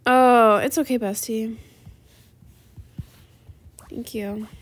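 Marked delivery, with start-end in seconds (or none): none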